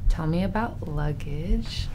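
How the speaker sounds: ordinary delivery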